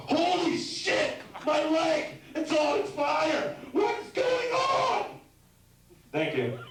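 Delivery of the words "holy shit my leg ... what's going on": very high, slow, screaming panic; panicked screaming. Pretend. Comic acting out his leg catching fire, screaming high. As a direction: shriek like your leg just caught fire. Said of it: "realizing my leg's on fire".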